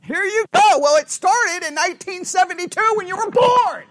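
high, loud mock tirade; hammed-up wife launching her list of grievances; indignant wife. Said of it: "and she's like".

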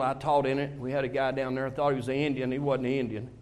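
usual speaking voice

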